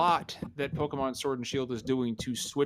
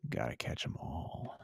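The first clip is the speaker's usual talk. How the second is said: much lower, softer breathy murmur; ASMR whisper; 11 semitones lower, 12 dB quieter than usual. Acted. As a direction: breathe it close and soft. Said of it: "Do we really wanna go here?".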